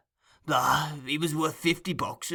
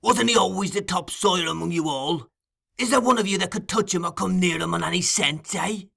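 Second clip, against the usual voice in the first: higher, louder challenge; angry rebuke; 8 semitones higher, 4 dB louder than usual. Acted. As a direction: demand an answer crossly. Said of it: "angry look at his pupil".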